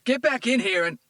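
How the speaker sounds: put-on man yelling at his girlfriend; furious yell. A narrator's reading. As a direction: scream it at her. Tito yells at woman.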